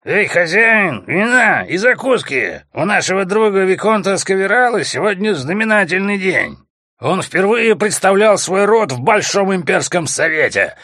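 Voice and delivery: loud, high bellowing brute; drunken shout. A narrator's reading. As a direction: bellow it across the tavern. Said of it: "самый здоровый среди всех заорал".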